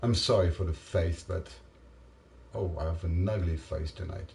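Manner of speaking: usual speaking voice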